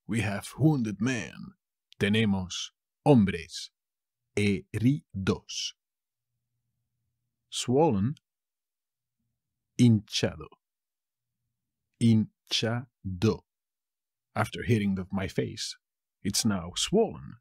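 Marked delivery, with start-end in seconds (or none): 4.3-5.7 slow, split "heridos"; teacher's slow syllable-by-syllable "heridos". Shown as a demonstration. Teacher shows new word syllable-wise. 12.0-13.4 slow "Hinchado", pause between syllables; teacher's slow, split-up "Hinchado". Shown as a demonstration. Word repeated split up for learners.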